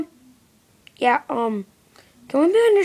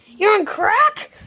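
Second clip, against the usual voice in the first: slow, high disbelief; shocked; 7 semitones higher, 3 dB louder than usual. Acted. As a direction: gasp it out appalled. Shocked accusation.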